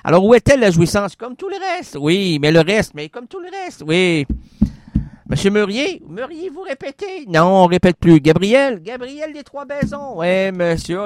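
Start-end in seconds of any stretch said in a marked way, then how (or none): 1.2-2.0 ghost voice. Acted. Spirit echoes. 2.9-3.8 ghost voice. Acted. Spirit echoes. 6.1-7.2 ghost voice. Acted. Spirit asks. 8.8-10.2 ghost voice. Acted. Spirit names itself.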